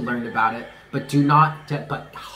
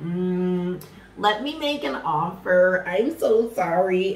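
high, slow mock-apologetic voice; guilt-struck apologizer; 4 semitones higher, slower than usual. Imagined ex coming back to make amends, mock guilty. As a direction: draw out a put-on, pleading apology. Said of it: "now the guilt hits them".

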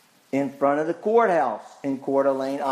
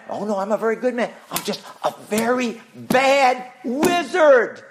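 higher, hammed-up wizard, half laughing; wizard's voice; 7 semitones higher, 3 dB louder than usual. The wizard answering Dorothy, hammed-up and amused. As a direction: wave it off like the wizard. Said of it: "He, she said".

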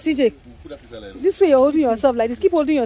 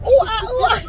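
monkey hoots; 8 semitones higher than usual. Pretend. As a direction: hoot it like an excited monkey. Mother copies child's monkey sound.

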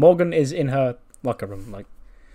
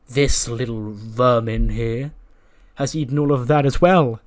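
mildly hammed-up, louder angry complaint; indignant accusation; 6 dB louder than usual. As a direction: point the blame with mock outrage. Acted accusation of food thief.

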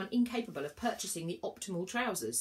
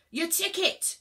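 loud, higher rude snap; snapping rudely; 12 semitones higher, 8 dB louder, slower than usual. A narrator's reading. Inspector snaps rudely at Virgo. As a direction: bite the words off at her. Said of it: "snapped the m-, mortal rudely".

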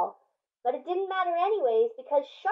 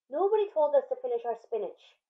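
ordinary delivery, nothing marked

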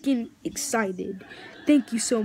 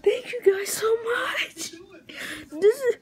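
high, emotional squeal of gratitude; real fanboy joy, voice way up high; overwhelmed, near tears; 8 semitones higher than usual. Real, not acted. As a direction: gush thanks as if about to cry. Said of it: "I'm literally gonna cry in a minute".

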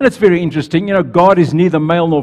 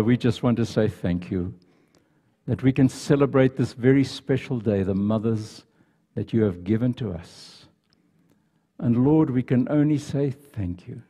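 soft, lower, reverent prayer voice; 4 semitones lower, 6 dB quieter than usual. Real, not acted. Pastor praying thanks for mothers, soft reverent prayer. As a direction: pray quietly and gratefully.

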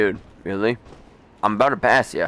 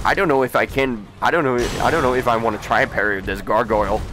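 fast nervous rambling; 2 semitones lower, much faster than usual. Real, not acted. Rushed worry mid-fight.

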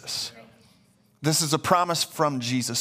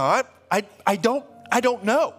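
higher-pitched, stammering confusion; flustered thief; 9 semitones higher, 4 dB louder than usual. Acted. Thief answering the angel at heaven's gate, flustered. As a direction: stumble over a baffled answer.

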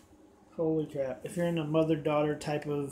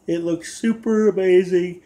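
excited; 2 semitones higher, 9 dB louder than usual. Real, not acted. Enthusing about food.

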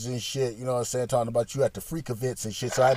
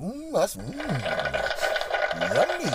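appreciative yummy; 4 semitones higher than usual. Retold or imitated. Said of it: "I was like".

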